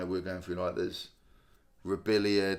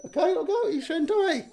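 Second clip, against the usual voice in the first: dad cooing to his daughter about the toy. Real, not acted. Father talking to his little daughter.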